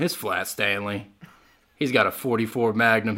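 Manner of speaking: usual speaking voice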